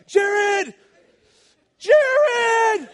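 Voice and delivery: panicked warning cry to a friend below; desperate yell. Retold or imitated. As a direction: yell his name as you hurtle down a slide. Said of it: "I'm now yelling at him".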